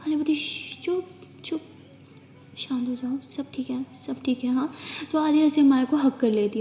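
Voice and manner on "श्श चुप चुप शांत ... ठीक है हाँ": softer shushing, almost a whisper; soft hushing. Acted. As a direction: hush her down gently. Aaliya hushing Amaya gently. (धीमे से चुप कराती आवाज़)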